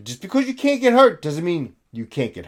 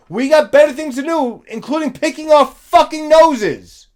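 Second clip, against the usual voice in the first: higher and louder, heated scolding; angry rant; 6 semitones higher, 6 dB louder than usual. Real, not acted. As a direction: rant at him, fired up. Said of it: "ranting at protester".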